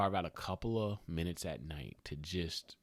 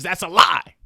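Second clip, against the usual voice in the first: loud outburst; 10 semitones higher, 17 dB louder than usual. Real, not acted. Punchline outburst.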